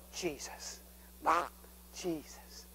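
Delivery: unvoiced, soft whisper; whispered name. Real, not acted. Preacher whispering Jesus in worship. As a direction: breathe the name in prayer.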